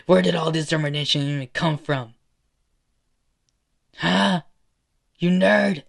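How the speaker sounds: loud, rising mocking demand; angry taunting. Villain taunts and berates the boy.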